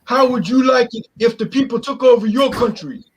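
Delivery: mock the aggrieved locals; mimicking French Canadians. Quoting complaining French Canadians.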